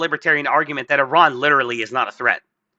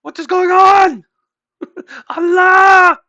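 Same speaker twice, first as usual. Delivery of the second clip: much higher, louder, panicked shriek; mock panic; 8 dB louder, slower than usual. Iranian radar operator as jets blow up, panicked shriek. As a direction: scream like a radar operator watching jets explode. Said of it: "and it's just like".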